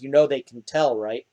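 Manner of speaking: soft knowing murmur; quiet. Acted. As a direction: say it low and hushed. Character quietly remarks.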